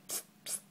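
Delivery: a breathy psst psst to catch the dog; unvoiced psst. Real, not acted. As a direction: hiss psst to get attention. Hissing to get dog's attention.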